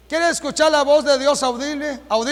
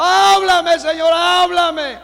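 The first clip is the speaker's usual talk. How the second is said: imitating a near-sobbing shouted plea, higher and louder; nearly crying plea; 6 semitones higher, 5 dB louder, much slower than usual. Preacher imitating a boy begging God to speak, nearly crying. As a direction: beg loudly as if about to cry. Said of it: "Y casi llorando".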